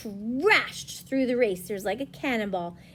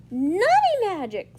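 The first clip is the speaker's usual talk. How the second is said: high, loud, drawn-out squeal; 9 semitones higher, 8 dB louder than usual. Acted. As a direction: squeal with storybook wonder. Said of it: "squealed the Hedgehog".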